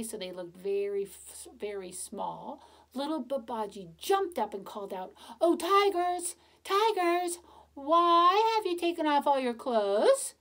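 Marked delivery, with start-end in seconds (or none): 5.4-10.3 higher, louder teasing call; boy teasing the tigers from far off. Acted. Babaji calls out from afar.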